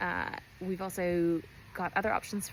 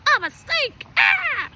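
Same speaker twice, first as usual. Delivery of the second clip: sudden high loud shriek; snake jump scare; 13 dB louder, much slower than usual. Retold or imitated. Snake startling the runner, shrieking. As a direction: leap out with a scream. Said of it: "they go".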